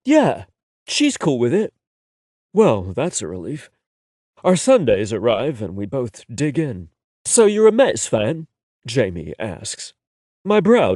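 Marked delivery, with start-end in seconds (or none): 0.1-1.7 easygoing Londoner, much higher on the yeah; breezy London accent; casual London lad. A narrator's reading. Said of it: "his London accent". 7.3-8.5 curious, higher and louder; light London accent, asking; a curious London lad. A narrator's reading. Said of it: "his London accent".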